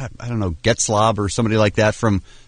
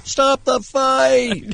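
high, drawn-out laughing "stop the fight"; imitating fight announcer; 12 semitones higher, much slower than usual. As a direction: echo the ref call, stretched way out. Laughing echo of the fight call.

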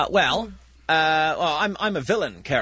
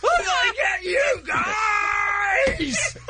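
high, drawn-out hammy villain yell; loud bad villain; much slower than usual. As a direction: holler threats like a villain heard a mile away. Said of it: "he's a bad villain. You can hear him a mile away".

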